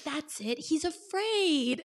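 mock a heroine's slow, high-pitched realisation; crazy woman. Reader imitating Harriet convincing herself he's afraid, mock crazy woman. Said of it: "you sound like a crazy woman".

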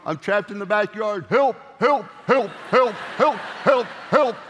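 high, loud pleading dog voice; voiced dog. Dog stuck in the backyard calling for help, high and loud. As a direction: cry for help like a trapped pup. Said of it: "what are they saying".

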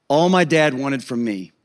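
ordinary delivery

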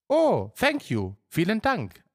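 high, drawn-out amazed thanks; disbelieving but hopeful. Acted. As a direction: thank them as if hardly believing the luck. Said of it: "etwas ungläubig, aber hoffnungsvoll". (etwas ungläubig, aber hoffnungsvoll, mit hoher Stimme)

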